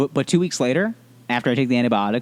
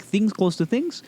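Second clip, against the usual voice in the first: higher and slower, mock-wise doctor; 10 semitones higher, slower than usual. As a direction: parody the doctor's explanation, pitched up and slowed. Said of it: "that's how he describes it".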